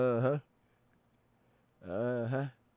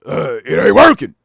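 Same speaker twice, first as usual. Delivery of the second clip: [name] voice; 11 dB louder than usual. Retold or imitated. [name] at the pump.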